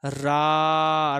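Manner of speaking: रा held long and loud as a three-beat vowel; drawn-out vowel. Shown as a demonstration. Teacher showing students a pluta vowel, रा drawn out loud. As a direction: stretch रा out well past a normal long vowel. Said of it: "तो थोड़ा सा लंबा उच्चारण करेंगे".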